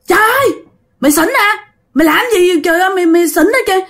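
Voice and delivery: high-pitched complaint after a hard slap; acted pained scolding. Mother to passenger who hit her back, scolding in pain. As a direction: cry out high, hurt and indignant, without full shouting. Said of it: "Mẹ la".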